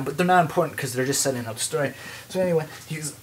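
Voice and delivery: ordinary talk, nothing marked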